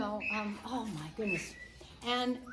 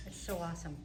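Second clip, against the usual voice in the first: normal talking voice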